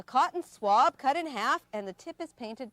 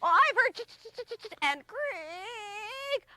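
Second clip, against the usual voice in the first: much higher squeaky critter voice; high, chattering little chipmunk; 9 semitones higher, slower than usual. Acted.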